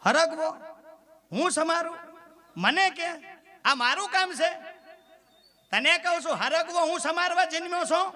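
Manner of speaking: indignant, high-pitched outburst at his wife; husband blows up over chopping drumsticks, pitched high; angry husband. Retold or imitated. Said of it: "પતિદેવનું મગજ ગયું". (ગુસ્સે થયેલો પતિ, ઊંચા સૂરે)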